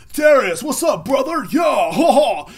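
high shouted mock bro greeting. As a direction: bellow it with a fake laugh.

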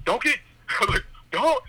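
high yell broken by laughter; retold yell. Yelling at the old man, laughing high mock yell. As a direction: start the shout and crack up. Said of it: "I was, like, yelling".

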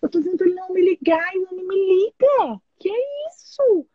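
high, fast, half-whispered disbelief; exasperated plea to God. Retold or imitated. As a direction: complain to heaven, rushing, amazed. (desabafo exasperado e acelerado com Deus)

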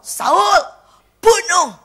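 high, very loud acted divine order; commanding shout. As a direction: call out each word as a command from God. Voices God's command to Saul. (seruan perintah Tuhan yang keras dan tinggi)

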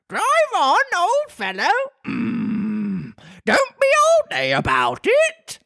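very high, squeaky creature voice; Griffin voice. A narrator's reading. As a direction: squeak it out, scolding.

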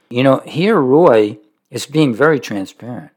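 usual speaking voice

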